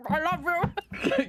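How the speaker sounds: chipmunk cheeks, slow and muffled; mouth full. Retold or imitated. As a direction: say it through stuffed cheeks. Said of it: "like a chipmunk with filled cheeks".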